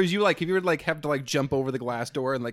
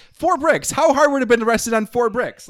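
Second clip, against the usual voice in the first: exasperated; 9 semitones higher, 8 dB louder than usual. Real, not acted. Indignant rant about thieves.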